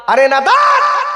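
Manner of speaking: a sudden high yelled rebuke; scornful shout. Acted. Preacher rebukes the man who credits his job, shouting in scorn. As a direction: bellow it, slow and mocking, at the fool.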